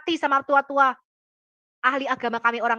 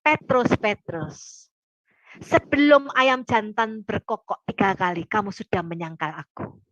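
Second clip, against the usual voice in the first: slow, lower warning to Peter; Jesus, slow and grave; 4 semitones lower, slower than usual. Retold or imitated. Jesus to Peter, slowly predicting the denial, grave and slow. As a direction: draw each word out like a solemn warning. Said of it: "Yesus ngomong apa?".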